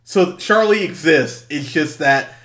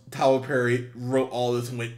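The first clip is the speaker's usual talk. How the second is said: normal talking voice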